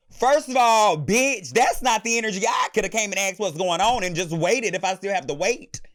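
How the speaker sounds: loud, heated outburst at the guard; angry rant. Real, not acted. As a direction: shout your outrage at him. Venting anger at lazy security.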